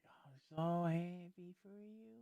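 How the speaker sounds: slow, soft, high sarcastic congratulation; through-the-teeth fake joy, slowly; slow soft sarcastic cheer; fake happiness. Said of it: "I'm sitting here like".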